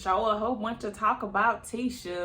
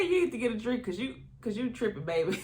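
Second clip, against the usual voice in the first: a hint of Stormy's sassy accent; imitated sassy accent. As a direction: mimic Stormy's sassy put-down, lightly. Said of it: "That accent killed me too".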